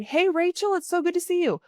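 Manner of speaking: cheerful high greeting to the screener; bright eager greeting. Shown as a demonstration. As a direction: greet her like an old friend, voice lifted high. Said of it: "Whoever's doing your phone screen".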